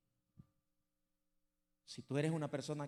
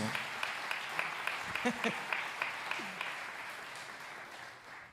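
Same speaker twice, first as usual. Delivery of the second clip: long laugh; 2 semitones higher than usual. Real, not acted. Preacher laughing after praising his congregation. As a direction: laugh warmly at your own words.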